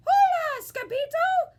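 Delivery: very high, loud happy hola; a small chihuahua shouting hello; grinning greeting. A narrator's reading. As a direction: greet an old friend with a grin.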